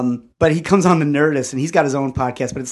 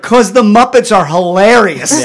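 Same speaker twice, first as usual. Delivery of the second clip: louder, slower, higher insistence; emphatic kid protest; 5 semitones higher, 8 dB louder, slower than usual. Retold or imitated. Nine-year-old defending TV show to dad, emphatic protest. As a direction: imitate a child stating the obvious.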